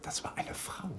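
unvoiced whisper, straining to listen; hushed whisper. Lawyer whispering to John about the footsteps. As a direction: whisper so the steps outside can't hear.

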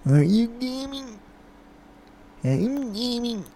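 high, spacey caller; prank caller voice. Pretend. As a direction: drift off like a confused caller.

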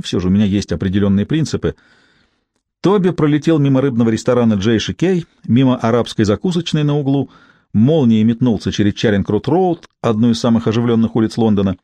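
ordinary delivery, nothing marked

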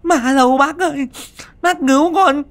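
put-on high, loud frightened cry to the old woman; panicked village woman begging for help; terrified wail. A narrator's reading. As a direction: shriek it out in panic. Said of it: "mồm oai oái".

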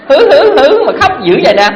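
hammed-up child whimper, hu hu; mock sobbing. The monk mimics the child crying over the candy jar, mock sobbing. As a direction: sob like a stubborn child, half laughing.

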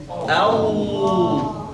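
أو held long with a full heavy lam; heavy lam demo. Shown as a demonstration. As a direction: draw out أو, thick and full. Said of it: "بتقول إيه؟". (لام مفخمة ممدودة)

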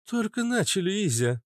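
very high female voice; high falsetto retort; Natasha. A narrator's reading. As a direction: tease back in a girlish voice. Natasha teases Katz back.

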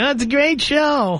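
high, slow sing-song mock wife; host mocking the wife in the background; mimicking the wife. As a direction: imitate her praising the show. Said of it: "in the background saying".

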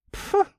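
short dismissive puff; scornful snort. A narrator's reading. Girl scoffing at her cousin's reproach, snorting. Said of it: "фыркнула Кристина".

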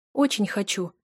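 plain reading voice

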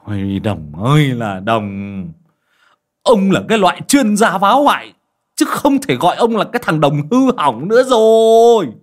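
fed-up master berating the culprit; weary exasperation. Acted. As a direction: despair at the ruined gate, then scold. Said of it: "lắc đầu ngao ngán".

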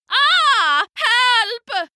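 high, loud scream for help; panicked cry. Acted. Haddo overrun by mice in his bedroom, panicked cry. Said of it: "he cried".

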